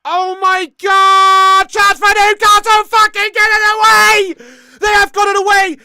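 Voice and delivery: drawn-out, high, full-volume panic yell; frantic shouting. Real, not acted. Watching Newcastle nearly score, frantic shouting. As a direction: scream it as the goal is nearly conceded. Live chance for Newcastle, near miss.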